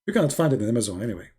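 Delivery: ordinary delivery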